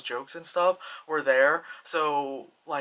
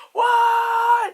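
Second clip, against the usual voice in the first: long, high shouted disbelief; loud drawn-out mock outcry; imitated crowd shock; 8 dB louder than usual. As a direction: shout it like a stunned movie crowd.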